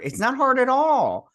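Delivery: high and loud, exasperated; emphatic. Real, not acted. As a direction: push it out, fed up. Insisting it's easy.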